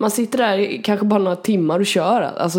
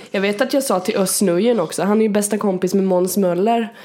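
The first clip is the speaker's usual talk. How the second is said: ordinary delivery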